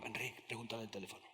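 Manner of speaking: very soft, quick urging; imitating a hushed, furtive request; hushed aside. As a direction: slip it in quickly under the breath. Woman's discreet aside to speaker.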